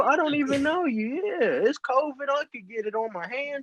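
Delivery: high-pitched, put-out ranting; mock indignant rant. Mock rant at door holder.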